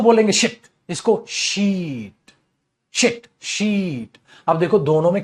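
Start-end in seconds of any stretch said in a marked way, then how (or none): 0.9-2.3 शीट with a very long stretched ee, slow; long ee demo. Shown as a demonstration. Teacher contrasting short and long ee for students. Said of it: "एक में आप बड़ी ई बोलेंगे". 2.9-4.2 शिट short and clipped, शीट long and slow; short then long ee. Shown as a demonstration. Teacher drilling short and long ee, slowly. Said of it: "एक में तो आप छोटी ई बोलेंगे".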